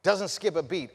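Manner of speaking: normal talking voice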